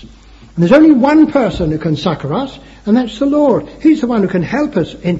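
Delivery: loud, high preacher's declaration; preaching. Real, not acted. Preacher naming the one who can help, preaching. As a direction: proclaim it with full pulpit force.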